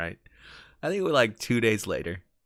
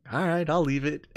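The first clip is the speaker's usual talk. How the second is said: lightly voiced, higher-pitched giving in; Bill backing down; 9 semitones higher than usual. Bill told to shut up by Arthur, high mock surrender. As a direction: give in with a raised mock voice. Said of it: "and Bill's like".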